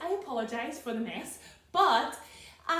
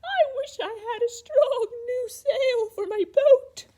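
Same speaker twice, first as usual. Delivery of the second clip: much higher and louder, slow wailing goat; bleating goat; 9 dB louder, slower than usual. Acted. Goat crying to George about its lost sail, high loud bleat. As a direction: wail it like an upset goat. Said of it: "bleating loudly".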